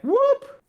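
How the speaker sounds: sudden high-pitched "whoop". Retold or imitated. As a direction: let out a quick high whoop. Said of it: "And then it's like".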